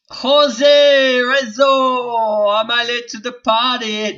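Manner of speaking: loud, high, long-drawn-out call; high, drawn-out shout hailing an arrival; hollered greeting. Acted. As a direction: holler the name, stretching the vowels right out. Hails viewer, reads their comment aloud.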